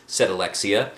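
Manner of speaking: ordinary delivery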